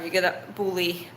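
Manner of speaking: normal talking voice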